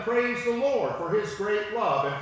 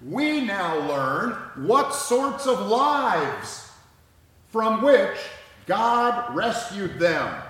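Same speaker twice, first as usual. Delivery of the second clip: loud, slow preaching emphasis; preacher hammering out the point; emphatic preaching; 2 semitones higher, 7 dB louder, slower than usual. Real, not acted. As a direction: drive each phrase home loudly and slowly.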